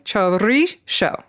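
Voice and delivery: Chorizo drawn out with a rolled r and sho ending; slow, deliberate Basque Chorizo, sh for z. Shown as a demonstration. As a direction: roll the r firmly in cho-RREE-sho. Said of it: "You roll the R".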